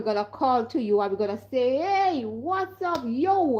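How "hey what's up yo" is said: a drawn-out hail to someone far off, higher; a slow, stretched greeting shouted from a distance; calling out across the street. Retold or imitated. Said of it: "we're gonna shout".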